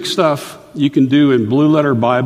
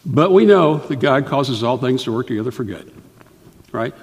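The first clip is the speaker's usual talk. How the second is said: ordinary talk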